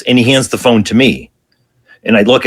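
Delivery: ordinary delivery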